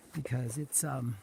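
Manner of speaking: ordinary talk, nothing marked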